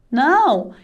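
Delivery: ordinary talk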